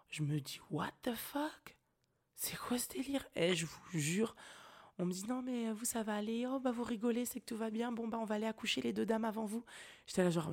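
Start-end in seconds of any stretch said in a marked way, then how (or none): 0.7-3.3 unvoiced, high, stunned whisper; shocked whisper. Shocked inner reaction to screams. 5.3-9.6 higher, breezy, dismissive nurse; mock nurse voice.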